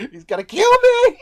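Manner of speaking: hammed-up scared shriek; panicked shriek. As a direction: squeal in mock terror. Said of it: "I'm like".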